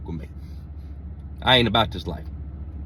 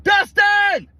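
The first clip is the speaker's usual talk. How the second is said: high, loud shout across a pasture; calling out far away in panic; hollering; 12 semitones higher, 5 dB louder, much slower than usual. Retold or imitated. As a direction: yell his name down the field. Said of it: "I hollered down the damn pasture".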